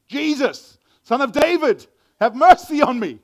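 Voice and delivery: loud, high desperate plea; big high-pitched shout; crying out. Shown as a demonstration. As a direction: cry it out at full voice to be heard. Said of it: "he cried out".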